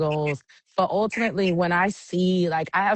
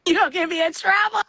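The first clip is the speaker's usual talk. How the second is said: high-pitched, quick mock alarm; much higher, hurried protest; playful protest; 12 semitones higher, faster than usual. Real, not acted. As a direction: squeal it as a teasing complaint. Playful protest at a tricky question.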